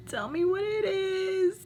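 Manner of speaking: high, slow, whiny plea with a laugh breaking in; a whiny, near-tears plea to the husband; exasperated whine. Retold or imitated. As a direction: plead like you're about to cry from frustration. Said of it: "it drives me insane".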